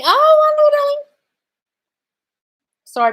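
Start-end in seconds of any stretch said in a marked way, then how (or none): 0.0-1.0 hugely high and loud "Oh, wonderful, darling"; theatrical sky-high gush of delight. Acted. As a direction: gush over it like a luvvie, way up high. Reacts to the affirmations.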